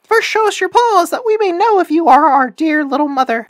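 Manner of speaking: put-on high, loud little-kid voices; kids crying out. A narrator's reading. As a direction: demand it in squeaky, shouted kid voice. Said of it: "The little kids cried".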